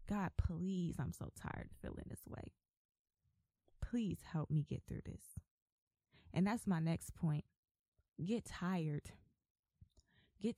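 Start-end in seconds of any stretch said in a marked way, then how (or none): 0.1-5.4 soft, breath-heavy plea falling to a near whisper; begging God. Retold or imitated. Worn-out believer begging God to help, hushed pleading. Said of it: "begging God to help me".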